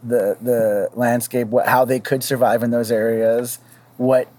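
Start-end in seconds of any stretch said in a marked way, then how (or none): none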